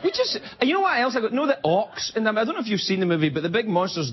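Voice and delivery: ordinary delivery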